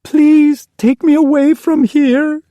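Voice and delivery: high, slow, desperate plea; girl begging mother to leave. Acted. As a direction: beg with everything. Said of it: "she begged".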